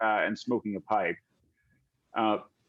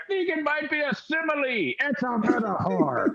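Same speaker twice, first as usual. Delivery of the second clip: high, pedantic heckler voice; squeaky know-it-all interjection; one of the crew; 13 semitones higher than usual. Acted. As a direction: chime in high from the back, correcting. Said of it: "you hear one of the guys say".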